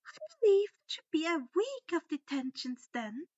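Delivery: high, sugary and loud; a sweet-voiced threat of punishment; Umbridge voice. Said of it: "she added on".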